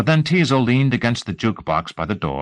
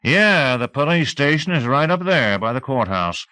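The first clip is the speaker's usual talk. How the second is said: gruff sheriff voice; 6 semitones higher than usual. A narrator's reading. Teasel voiced in read novel.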